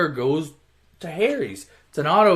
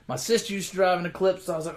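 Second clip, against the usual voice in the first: ordinary delivery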